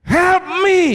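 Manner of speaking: high, loud yell; shouted cry for help. Acted. Preacher acts out calling for help, shouting. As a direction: scream the plea for help.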